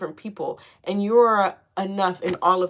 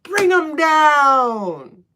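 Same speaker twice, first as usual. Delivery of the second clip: loud, very slow, higher demand; mock vengeful demand; 5 semitones higher, 7 dB louder, much slower than usual. Reader retelling her rage, slow loud demand. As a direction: drag out the order to bring them down. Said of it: "I said".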